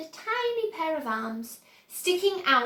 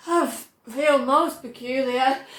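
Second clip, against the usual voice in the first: gasped; 5 dB louder than usual. A narrator's reading. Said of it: "gasped Violet".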